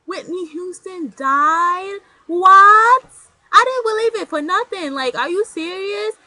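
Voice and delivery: very high, slow, stretched-out "Are you serious? What?"; shocked disbelief. Retold or imitated. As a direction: replay the moment the news hit you.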